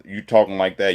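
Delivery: usual speaking voice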